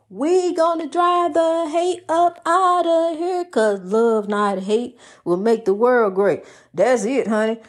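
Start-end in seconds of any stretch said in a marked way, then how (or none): 0.1-3.4 high, slow rallying call; rallying cry. Real, not acted. Preacher rallying her listeners, slow and high. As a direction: lift the room, drawn out and high.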